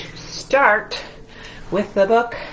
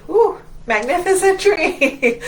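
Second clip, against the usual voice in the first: high, loud cheer; excited woo; 8 semitones higher, 4 dB louder than usual. Acted. Yoga teacher cheering the kids' tree pose with a whoop. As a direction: whoop with delight.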